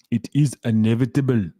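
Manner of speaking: hammed-up Arnold-style Austrian accent on a film quote. Streamer quotes a film line to chat in a bad Arnold Schwarzenegger accent. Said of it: "Excuse the accent again".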